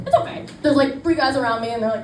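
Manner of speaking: normal talking voice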